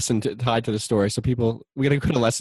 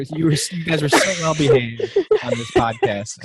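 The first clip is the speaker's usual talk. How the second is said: talking through real laughter; half-laughing teasing; 2 semitones higher than usual. Real, not acted. Laughing at the hosts.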